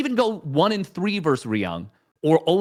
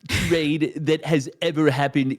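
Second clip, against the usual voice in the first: Trump impression; 5 dB louder than usual.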